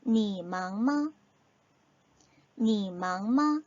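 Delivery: 니 망마 said slowly, syllable by syllable in Chinese tones; slow, clear Chinese demo for learners; slow Chinese model. Shown as a demonstration. Teacher models the Chinese phrase slowly for students. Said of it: "다시 한번 해볼까요".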